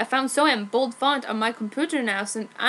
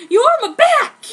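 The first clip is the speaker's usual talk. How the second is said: much higher, loud joyous cry; hammed-up delighted friend; overjoyed; 13 semitones higher, 9 dB louder than usual. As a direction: burst out happily. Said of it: "said John".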